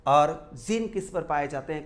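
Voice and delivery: usual speaking voice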